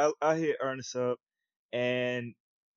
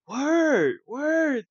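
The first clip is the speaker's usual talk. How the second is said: much higher, louder "Word, word"; the moment the penny drops; shocked; 13 semitones higher, 5 dB louder than usual. Retold or imitated. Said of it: "Oh, I started dating her around February".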